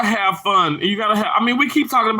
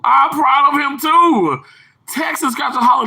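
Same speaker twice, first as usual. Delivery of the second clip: proud cheer; 8 semitones higher, 4 dB louder than usual. Real, not acted. Streamer cheering a viewer's son, proud and excited.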